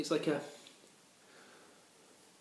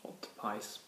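octopus; 2 semitones lower, 8 dB quieter, slower than usual. Pretend. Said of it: "my octopus impression".

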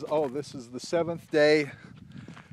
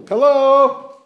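loud, high call into the empty store; calling down a hallway; 14 semitones higher, 13 dB louder than usual. Real, not acted. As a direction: shout out to find someone in back.

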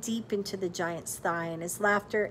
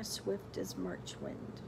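slow, much lower and softer; slow demonstration; 12 semitones lower, 11 dB quieter, slower than usual. Shown as a demonstration. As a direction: savour the simile slowly. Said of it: "Do you hear the simile in there?".

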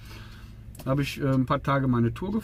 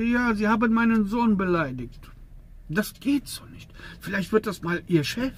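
higher, scolding boss voice; mock stern boss; 8 semitones higher than usual. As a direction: play the boss telling him off, pitched up. Boss scolds him in the office. (gespielter strenger Chef, höher)

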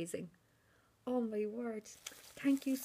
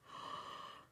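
sharp breathless gasp of wonder; delighted gasp; 8 dB quieter than usual. Real, not acted. Seeing the little bird, gasping with delight. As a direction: gasp in surprise. Gift revealed.

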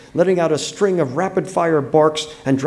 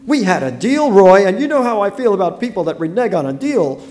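high, loud accusation; indignant reproach; 7 semitones higher, 6 dB louder than usual. Acted. Wife accusing husband of backing out, indignant. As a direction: hold him to it, voice raised.

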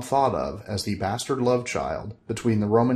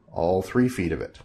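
ordinary delivery

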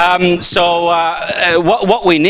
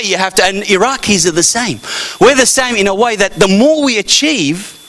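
ordinary talk

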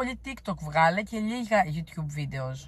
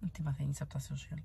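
a much softer unvoiced whisper; whispered aside; 4 semitones lower, 10 dB quieter than usual. Real, not acted. Musing to herself about social media, whispered. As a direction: whisper it to yourself.

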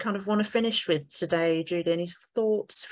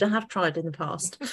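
usual speaking voice

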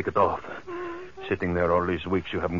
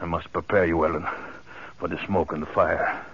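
ordinary talk

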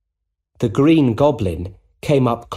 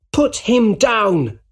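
slow, high commanding shout; 11 semitones higher, 3 dB louder, slower than usual. A narrator's reading. Spider-Man orders Goblin to release Jameson, shouting. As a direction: shout the order, drawing out each word. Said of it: "he shouted".

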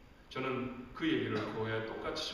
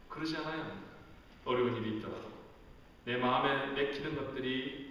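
usual speaking voice

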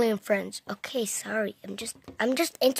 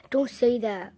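usual speaking voice

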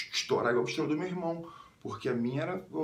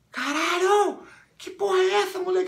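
very high, loud mock shriek; shocked outcry; 7 dB louder than usual. Friend spotting the bandage while peeing, high shocked shout. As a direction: yell it in startled disbelief.

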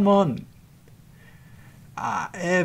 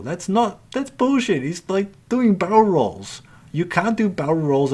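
high, loud indignant yelp at the game; rising outrage, voice jumping up loud; exasperated outburst; 8 semitones higher, 6 dB louder than usual. Real, not acted. Gamer yelling at a dodging enemy ship, high and loud.

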